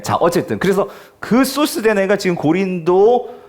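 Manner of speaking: ordinary talk, nothing marked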